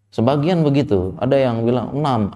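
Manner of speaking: usual speaking voice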